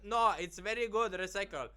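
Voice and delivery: loud, high mock Persian mother accent. Son voicing Persian mother defending recycling, imitated foreign accent.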